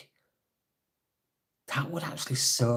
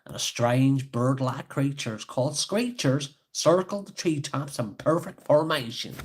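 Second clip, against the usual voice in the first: put-on Scottish accent; 4 semitones higher, 4 dB louder than usual. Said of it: "maybe I should read in a Scottish accent".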